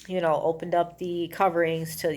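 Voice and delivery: ordinary talk, nothing marked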